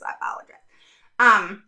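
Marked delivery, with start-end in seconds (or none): 0.0-0.6 whispered apology. Real, not acted. Apologizing to viewers while juggling computers, whispered. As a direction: breathe it out as a quick aside.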